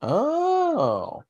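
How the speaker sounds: high surprised gasp of oh; a sudden high "Oh" of realization. Real, not acted. Sudden realization about the Night Sisters.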